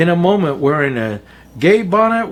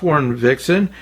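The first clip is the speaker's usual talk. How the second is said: ordinary delivery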